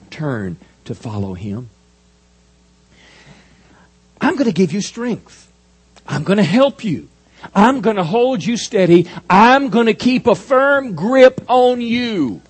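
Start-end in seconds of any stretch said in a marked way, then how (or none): 4.2-12.4 high, loud, slow preaching build; full-force preaching. Acted. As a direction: thunder out God's promise. Preaching God's promise at full force.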